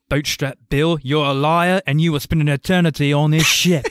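lightly voiced Davy Jones threatening Bootstrap Bill; mock villain growl, only a hint of accent; quoting Davy Jones. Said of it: "My favorite line of his is".

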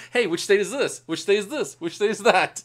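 pointing at a map, asking which state, animated; eager quizzing. Retold or imitated. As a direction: quiz someone over a map, keen. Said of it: "It's like".

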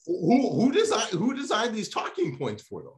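high, loud, incredulous questioning; exasperated rant. Real, not acted. Ranting to co-host about the brand's talking points, exasperated. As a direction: demand an answer, voice shooting way up. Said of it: "I'm going off on a rant".

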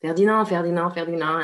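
Ferdinand repeated the French way, fair-dee-NAHN; French pronunciation. Retold or imitated. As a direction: rattle off Ferdinand as in French, no final d. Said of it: "you think Ferdinand, right? And I did the whole".

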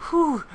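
sharp gasp, then high, loud whew; excited gasp. Real, not acted. Gamer overwhelmed at being back in, excited gasp.

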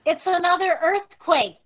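high, loud startled cry as the ground shakes; alarmed cry. Acted. Woman at the tomb cries out as the earth quakes, alarmed. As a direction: shout it in alarm, caught off guard.

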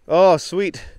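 loud, high burst of delight; real delight at an embossed bottle; thrilled find. Real, not acted. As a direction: cheer at the lucky find.

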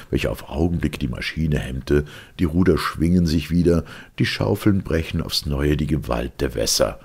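ordinary delivery

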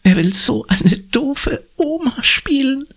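very high, whispery mocking voice; quiet snickering. A narrator's reading. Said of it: "Nur Stella kichert leise". (leise gekichert, sehr hoch)

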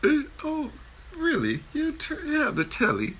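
hammed-up high British lady's voice; mock British lady. Dad imitating his daughter's British accent, high mock ladylike voice. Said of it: "I'm trying to sound a lady".

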